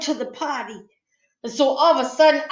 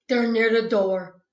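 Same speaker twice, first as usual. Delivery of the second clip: plain reading voice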